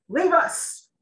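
sudden loud, high command; king shouts at the guard to leave; abrupt shout. Acted. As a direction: bark the order to clear the room. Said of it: "King abruptly shouted".

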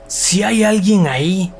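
desperate slow plea; pleading. Acted. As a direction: beg for anyone to answer. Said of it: "suplicó Mike".

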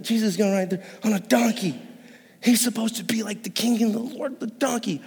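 muttering to each other in shock; disbelieving disciples. Retold or imitated.

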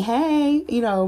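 ordinary talk, nothing marked

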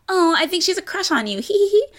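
high, sugary condescending voice with a mocking giggle; condescending girlfriend. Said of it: "Charlie's attitude is like".

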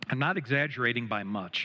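ordinary talk, nothing marked

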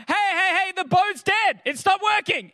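high-pitched urgent calling out; retold yelling. Family adrift calling to the boat guy, retold yelling. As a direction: re-enact hailing someone in alarm, pitch jumped way up. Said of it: "we start yelling out to the boat guy".

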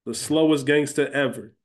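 usual speaking voice

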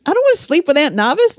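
put-on hesitate, then plead like an unwilling kid; reluctant child. Child protesting to mom about sharing a bed, acted reluctance.

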